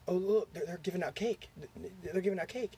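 lightly acted eager pointing-out; mock-eager big brother. Brother distracting his little sister.

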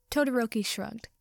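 plain reading voice

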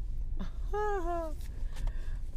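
long high laugh; high, amused chuckling; real laugh. Real, not acted. Driver tickled by the overheard gentleman, high laugh.

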